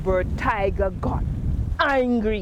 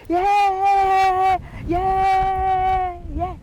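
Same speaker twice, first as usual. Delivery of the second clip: high, loud crowd cheer; yelling yeah from the crowd; 9 semitones higher, 3 dB louder, much slower than usual. Acted.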